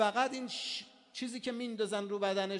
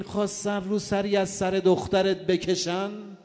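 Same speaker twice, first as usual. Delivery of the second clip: mourning lament recital; 7 semitones lower, 9 dB louder than usual. Acted. Sakina calling to her father at the slaughter site, in a loud wailing lament. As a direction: cry out a daughter's grief to her slain father.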